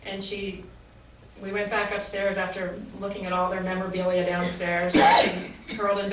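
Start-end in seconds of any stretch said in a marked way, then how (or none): none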